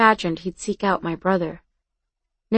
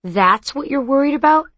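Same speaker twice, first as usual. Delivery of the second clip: higher-pitched, disbelieving question; stunned; 6 semitones higher, 4 dB louder than usual. A narrator's reading.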